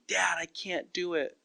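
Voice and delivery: lightly voiced child, whiny and soft; imitating whiny child. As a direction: plead like a little kid who gave up. Kids whining to their dad.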